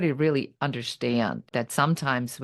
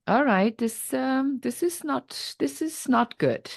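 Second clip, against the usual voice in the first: higher, alarmed inner voice; a rising sense of panic, pitched up; 10 semitones higher, slower than usual. Retold or imitated. Panic on hearing bad news.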